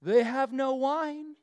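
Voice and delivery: slow, pointed hint from Mary. Retold or imitated. Mary hinting to Jesus, slow and pointed. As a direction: drop the hint slowly, loaded with meaning. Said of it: "There's the tone".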